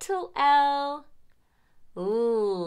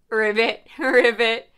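frog ribbit; 2 semitones lower, 7 dB louder than usual. Shown as a demonstration. Teacher makes frog sound.

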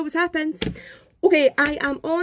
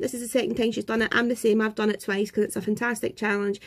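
ordinary talk, nothing marked

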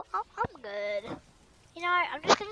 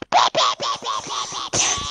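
much lower growly pews; low blaster noises; deep pew noises; 7 dB louder than usual. Pretend. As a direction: gun sounds from the chest. Deep mouth gun sounds.

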